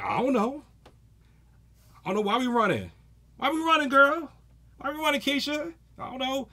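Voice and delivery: put-on high, bewildered girlfriend asking why they run; Keisha impression. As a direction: squeal it like a confused friend mid-sprint. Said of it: "just a generic name".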